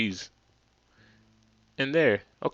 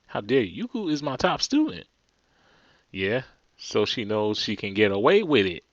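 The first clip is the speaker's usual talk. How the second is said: ordinary talk, nothing marked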